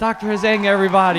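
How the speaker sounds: high, loud hype-man announcement; big guest intro. Acted. Host to listeners, loud showman welcome. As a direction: hype the doctor like a game show host.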